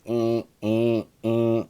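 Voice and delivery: repeated, even buzzer "eh"; irritating alert buzzer. Shown as a demonstration. Teacher imitating square-wave alert buttons, irritating buzz. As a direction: imitate an alarm button's buzz in short bursts. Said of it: "They're irritating".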